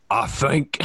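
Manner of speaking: gruff Statham voice; Statham impression. Shown as a demonstration. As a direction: say it like Jason Statham. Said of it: "So I just do".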